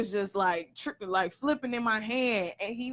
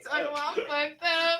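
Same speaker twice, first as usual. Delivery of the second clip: laughing; 7 semitones higher, 6 dB louder than usual. Real, not acted. Laughing at drunk friend.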